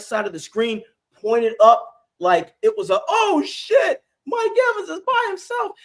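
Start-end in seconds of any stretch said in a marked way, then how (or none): 3.1-5.7 high squeaky panicked yelp; shocked corner. Retold or imitated. Cornerback panicking as the receiver runs free, high yelp. Corner realizing he's beaten.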